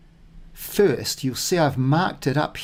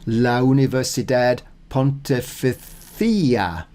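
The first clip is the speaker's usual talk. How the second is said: "La Universidad Pontificia" read slowly, syllable by syllable; slowly and carefully, as if practising; slow deliberate practice; slower than usual. Shown as a demonstration. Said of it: "the emphasis there".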